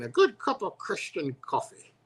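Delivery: high, put-on Irish lilt; bad Irish accent. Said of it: "I can't do an Irish accent".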